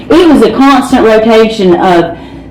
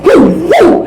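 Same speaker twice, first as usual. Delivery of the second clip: mock cheer; 10 semitones higher than usual. Paramedic's cry as boy revives.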